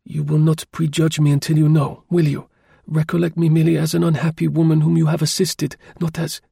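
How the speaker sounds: an unhappy woman begging not to be judged; pleading sigh. A narrator's reading. Lady begging the driver not to prejudge her, sighing. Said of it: "she sighed deeply without concluding".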